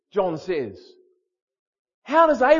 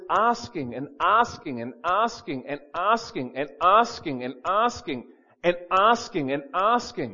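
"asking" punched in a repeating sing-song rhythm; relentless repetition; 4 semitones lower than usual. Shown as a demonstration. Preacher shows persistent prayer, "asking" repeated in relentless even beats. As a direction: keep hammering "asking", over and over.

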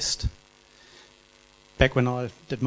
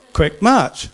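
shouted, drawn-out drill call; drawn out parade commands; 7 semitones higher, 7 dB louder than usual. Retold or imitated. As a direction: bark the order to march.

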